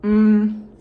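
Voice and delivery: loud mm of tasty food; savoring hum. Real, not acted. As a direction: hum with pleasure while eating. Eating during the story.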